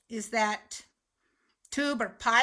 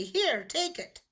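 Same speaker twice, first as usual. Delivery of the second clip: offering money; 6 semitones higher than usual. Pretend. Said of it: "thrust the money to you to say".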